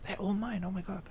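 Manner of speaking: high, soft mock-child voice; hushed, high-pitched imitated glee; imitating overexcited kid. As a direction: squeak it like an awestruck child. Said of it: "He was so gassed".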